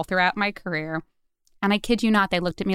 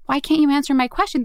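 raised, exasperated question, higher and louder; frustrated retold demand; 7 semitones higher, 3 dB louder than usual. To a demand gen team stuck on leads, frustrated and raised. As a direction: let the frustration spill into the question. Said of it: "I'm getting super frustrated".

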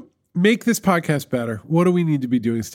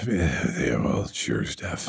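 low rumbly whisper; 6 dB quieter, slower than usual. Pretend. Playing up his rumbly voice.

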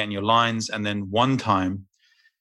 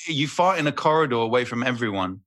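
normal talking voice

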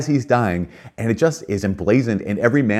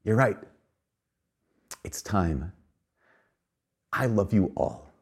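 soft, slow goodbye to the family; dying man's quiet last words; tender farewell; 8 dB quieter, slower than usual. Retold or imitated. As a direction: fade out lovingly, very softly.

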